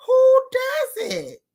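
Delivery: incredulous. Real, not acted. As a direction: stretch it out in shock. Incredulous at the reel.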